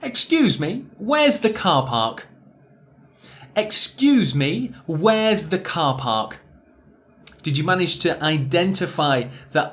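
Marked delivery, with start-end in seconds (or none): 0.0-2.3 higher and louder, car park with long ah; clear example sentence. Shown as a demonstration. Teacher reads the example to the class. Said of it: "let's take a look at a few examples". 3.6-6.4 slow model reading. Shown as a demonstration. Teacher slowly models the example sentence. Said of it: "One more time".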